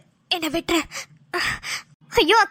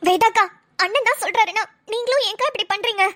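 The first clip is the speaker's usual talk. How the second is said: ordinary delivery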